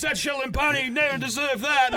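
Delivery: high, loud mock yell; hammed-up yelled accusation; mock shout. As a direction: bellow the monk's parting shot. Monk's parting line retold.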